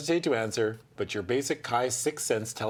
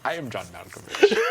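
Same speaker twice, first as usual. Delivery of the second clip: John Malkovich impression; 2 semitones lower, 3 dB louder than usual. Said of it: "do impressions".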